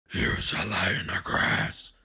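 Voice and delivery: low whispered advice; a hushed animal voice; whispered suggestion. Acted.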